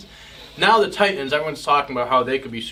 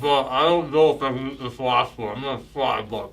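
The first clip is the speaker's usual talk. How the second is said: talking with mouth full of bun; words round a mouthful; 2 semitones higher than usual. Real, not acted. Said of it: "[name]'s got a mouthful of bun".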